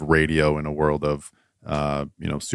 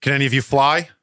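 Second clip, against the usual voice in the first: higher-pitched call to the pilots; raised yell; 9 semitones higher, faster than usual. Acted. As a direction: call it out as a mild, urgent yell. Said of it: "looks at you and yells".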